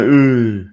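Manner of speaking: loud, drawn-out mocking ooh. Imitating the fan's reaction to being called a tit, loud mock ooh. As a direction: ham up the fan's shocked ooh. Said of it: "He's like".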